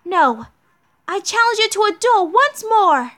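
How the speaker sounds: high, loud acted challenge; defiant knight heroine. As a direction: cut them off and demand a duel. Voices Mashu issuing a challenge.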